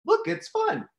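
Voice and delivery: put-on high, delighted child calling out; a small boy thrilled with his toy; gleeful little kid. Little boy showing mom the roach, high and delighted. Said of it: "I'm just like".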